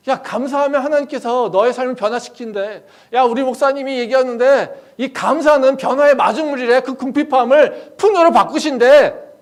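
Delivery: loud, high, building enthusiasm; excited urging. Acted. Friend passing on the pastor's message on thanksgiving, excited urging.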